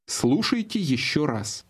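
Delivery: usual speaking voice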